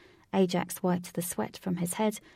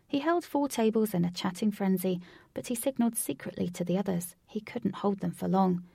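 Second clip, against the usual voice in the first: ordinary delivery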